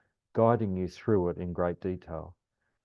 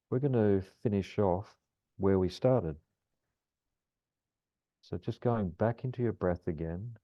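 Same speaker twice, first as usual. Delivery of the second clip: calm grounding exercise. Acted. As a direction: ease them gently through the breath. Guides a grounding meditation.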